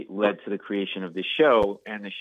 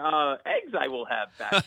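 reversed; 4 semitones higher, slower than usual. Retold or imitated. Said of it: "the backwards talking thing".

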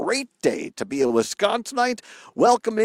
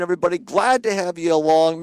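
normal talking voice

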